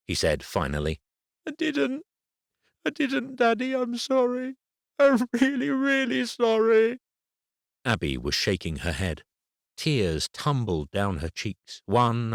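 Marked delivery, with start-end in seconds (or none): 1.5-7.0 put-on a hurt girl accusing the boy; tearful little girl. A narrator's reading. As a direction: daughter telling her dad through tears. Crying daughter pleads.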